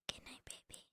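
faint whispered goodnight; pillow talk. Acted. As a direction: breathe it out as she drifts. Whispered goodnight, then voiced.

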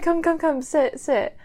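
ordinary talk

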